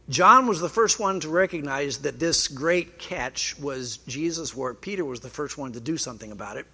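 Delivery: ordinary talk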